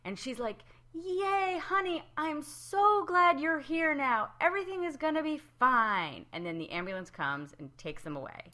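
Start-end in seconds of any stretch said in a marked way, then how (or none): 0.9-6.2 high, slow, sing-song gushing relief; wife greeting her husband, hammed-up gushing; mock relieved wife. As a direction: gush at the husband like a sitcom wife.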